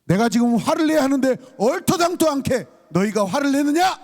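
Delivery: high-pitched acted outrage; indignant rhetorical question. Psalmist confronting the angry nations, in indignant rebuke. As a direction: challenge them as if their anger were absurd. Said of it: "역설적으로 질문을 던지고 있어요".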